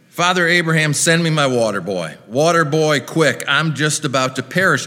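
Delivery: put-on toned-down Southern holler for the water boy; Southern accent. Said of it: "I had no plans to do an accent".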